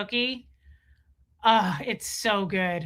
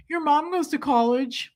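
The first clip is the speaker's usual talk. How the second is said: Napoleon Dynamite quote; 5 semitones higher than usual. Retold or imitated. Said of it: "Napoleon Dynamite's classic good humor".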